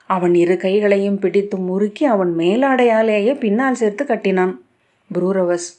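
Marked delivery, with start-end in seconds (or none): none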